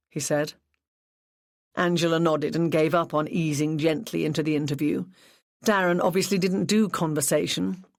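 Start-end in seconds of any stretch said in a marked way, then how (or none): none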